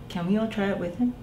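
ordinary delivery, nothing marked

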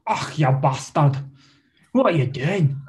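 mock-scolding Scottish brogue; Scottish accent. Shown as a demonstration. Comic demonstrates Scottish by cursing someone, mock-indignant Scottish accent.

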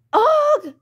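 loud, high frustrated groan; exasperated yelp. Acted. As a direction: burst out like a fed-up kid. Child's tantrum.

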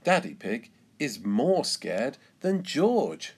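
plain reading voice

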